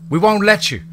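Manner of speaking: high, loud defiant yell; a freed slave yelling defiance at the landowner. A narrator's reading. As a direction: throw it back at him, high and loud. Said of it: "another voice yelled".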